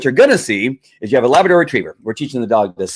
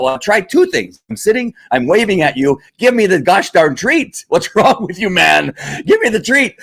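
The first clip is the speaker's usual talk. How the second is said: higher, pushy dog demanding its treat; impatient dog voice; 6 semitones higher than usual. Retold or imitated. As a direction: play the fed-up dog, rising pitch. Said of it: "the dog's going".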